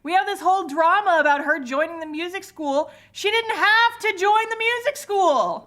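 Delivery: comic exasperation. Real, not acted. Fan ranting about the music school drama, comic exasperation. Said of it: "I'm so mad about these teens".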